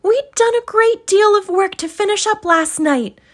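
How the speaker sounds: a daughter explaining herself; high girlish reply; young girl's voice. Acted. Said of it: "replied the girl".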